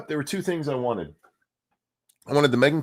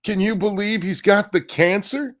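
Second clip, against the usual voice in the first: mocking fake shock; 7 semitones higher than usual. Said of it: "all you fuckers are like".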